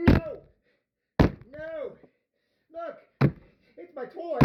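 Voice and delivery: high-pitched mock tantrum; toy-squabble voice. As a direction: squeal possessively, play-acting. Acts out fight over a toy.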